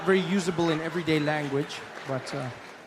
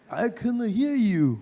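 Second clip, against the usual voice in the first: put-on Japanese accent; 5 semitones higher, 3 dB louder, slower than usual. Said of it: "he's like".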